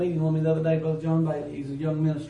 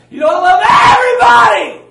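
much higher and louder, shouting it out; a full-force rebuke thrown at the pews; preaching shout; 13 semitones higher, 17 dB louder than usual. Real, not acted. Preacher shouting at the congregation that they don't love everybody.

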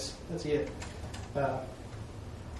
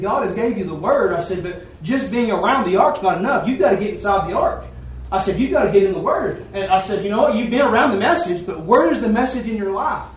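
loud, high preaching to the congregation; retold preaching; 4 semitones higher, 7 dB louder than usual. Preacher pressing the congregation to get in the word, loud. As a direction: preach it out, driving each line.

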